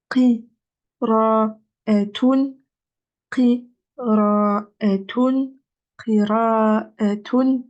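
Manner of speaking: qira'atun said slowly, syllable by syllable; drawn-out teacher demonstration of qira'atun; slow model reading. Shown as a demonstration. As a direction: spell out qira'atun slowly for the class. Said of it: "teacher models word slowly".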